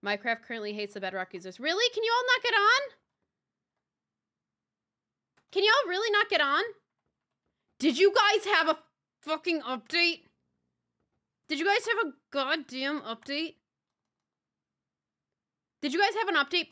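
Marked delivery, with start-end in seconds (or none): 1.6-6.6 much higher and louder, incredulous; loud shocked question; high outraged disbelief. Real, not acted. Outraged at Bedrock issue. 7.8-16.7 louder, annoyed demand; loud frustrated question; irritated pleading shout; exasperated. Real, not acted.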